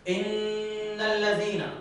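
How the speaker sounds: drawn-out recitation holding the nasal ghunna; slow Quranic recitation with strong nasal hum; slow tajweed recitation. Shown as a demonstration. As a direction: recite slowly, lingering on the doubled nun. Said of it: "الغنة في الحرف المشدد".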